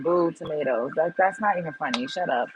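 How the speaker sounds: ordinary talk, nothing marked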